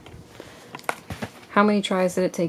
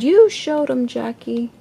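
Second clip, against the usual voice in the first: cheering; 5 semitones higher, 4 dB louder than usual. A narrator's reading. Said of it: "stood up in his seat and cheered".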